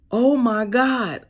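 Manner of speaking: sharp gasp, then a slow, amazed oh my God; stunned by the tiny repair bill; gasp of surprise. Retold or imitated. Hearing the car only needs a spark plug, gasping amazed. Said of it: "So now I'm like".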